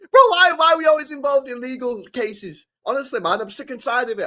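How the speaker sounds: venting real frustration, voice up high; exasperated rant. Real, not acted. Fed up with legal cases.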